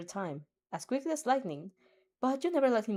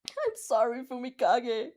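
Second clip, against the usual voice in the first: hurried, pleading sorry in another voice; panicked apology; 4 semitones higher, slower than usual. A narrator's reading. Dark Shadow apologizes to his master in panic. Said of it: "Dark Shadow explains in panic".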